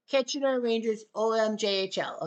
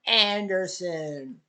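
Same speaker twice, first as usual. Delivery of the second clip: slow, lower, drawn-out Anderson; menacing movie villain; 4 semitones lower, much slower than usual. Acted. Movie villain line to the player, slow and lower. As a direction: drag out Anderson like a cold villain.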